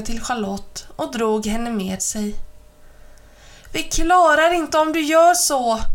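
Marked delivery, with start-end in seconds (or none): 3.7-5.8 breathless, high and loud protest; panting. A narrator's reading. Charlotte panting at Ben as they flee, high and loud. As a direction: gasp the words out while running. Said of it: "flåsade hon".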